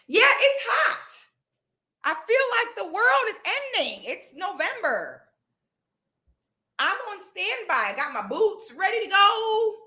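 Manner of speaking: high-pitched, excitable heat rant; hyped high complaining. Real, not acted. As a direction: rant about the weather in a high, giddy voice. Hyped complaining about November heat.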